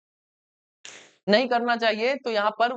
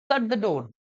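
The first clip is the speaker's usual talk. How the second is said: polite request; much slower than usual. Shown as a demonstration. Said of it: "request वाला भाव".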